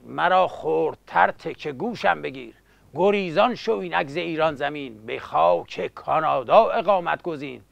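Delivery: epic teahouse storyteller. Acted. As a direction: recite the bard's tale quietly.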